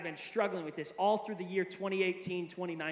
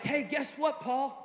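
imitated excitement; 6 semitones higher, slower than usual. Retelling a friend's excited news.

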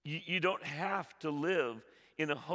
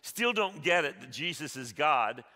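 loud, high, fervent preaching; preaching at full force; 8 semitones higher, 9 dB louder than usual. Acted. Preacher urging the congregation, loud and fervent. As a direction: drive it home to the congregation.

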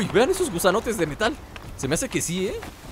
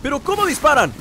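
high, loud protest; alarmed disbelief; 8 semitones higher, 4 dB louder than usual. Real, not acted. Gamer under fire from attackers, alarmed disbelief. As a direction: cry out in outraged disbelief.

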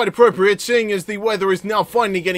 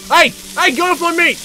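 high-pitched, outraged telling-off; indignant yelling; 9 semitones higher than usual. Real, not acted. Gamer chasing flies off his meat, high indignant yelling. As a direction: shoo them off in a raised, agitated voice.

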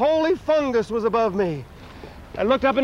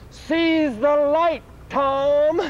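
very slow, higher, drawn-out call; a distant, higher voice stretching every word; 3 semitones higher, much slower than usual. Acted. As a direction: call it slowly from far away. Pope's voice from the distance.

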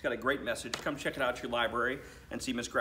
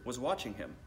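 plain reading voice

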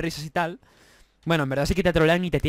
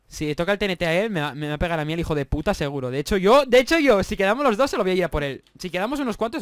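normal talking voice

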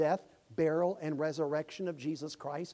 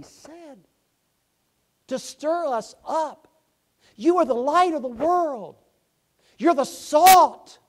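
much higher, louder, slow, building to a shout; preaching climax; 12 semitones higher, 19 dB louder, slower than usual. Acted. Preacher urging the church to shine, building to a shout. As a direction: rise to a thundering call.

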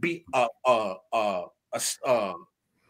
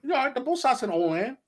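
high, breezy unbothered voice; Christophe voiced; 10 semitones higher than usual. Christophe shrugging off land reform, high and breezy. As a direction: pitch it way up, carefree and dismissive. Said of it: "Christophe was like".

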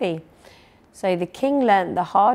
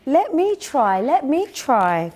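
eager, higher-pitched plea; storybook cry, pitched up; 6 semitones higher, 4 dB louder than usual. A narrator's reading. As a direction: call out as a keen pupil wanting a turn. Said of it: "cried Peter".

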